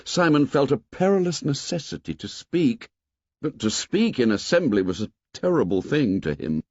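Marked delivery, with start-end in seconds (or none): none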